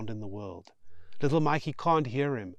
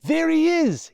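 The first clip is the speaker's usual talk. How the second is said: high, loud call pointing someone out; excited yell spotting a friend; 10 dB louder than usual. Acted. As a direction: shout it across the road. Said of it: "he yells".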